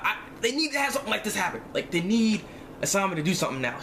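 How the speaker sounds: ordinary talk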